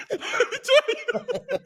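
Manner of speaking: drawn-out laughing high squeal. Real, not acted. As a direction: laugh through the words, slowly.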